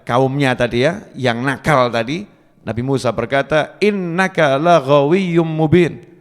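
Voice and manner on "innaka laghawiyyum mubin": slow, louder, firm rebuke in Arabic; stern and firm. Retold or imitated. Musa sternly rebuking the brawling Israelite, slow and firm. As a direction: lay down the verdict slowly and sternly. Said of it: "kata-kata yang keras, ya, dan tegas".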